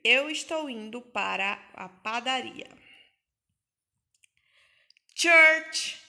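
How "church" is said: Church called out high and loud; teacher introducing vocabulary; loud model word. Shown as a demonstration. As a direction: announce the new word to the class. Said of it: "é igreja".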